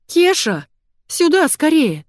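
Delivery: high, loud call for help; urgent high-pitched holler; yelling. A narrator's reading. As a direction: yell for someone in the next room. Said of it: "и заорала".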